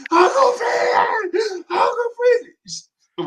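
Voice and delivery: very high, slow, drawn-out calling cry; auntie calling out. Retold or imitated. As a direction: call across the yard like a frantic auntie. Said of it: "my auntie was calling my name".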